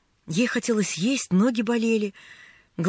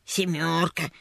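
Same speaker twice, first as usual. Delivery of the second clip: lower, drawn-out sneering echo; mocking mimicry; 5 semitones lower, slower than usual. A narrator's reading. Newsboy parrots the lost girl's answer, mocking. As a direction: mimic her word mockingly, pitched down. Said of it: "передразнил её мальчик".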